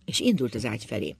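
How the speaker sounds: plain reading voice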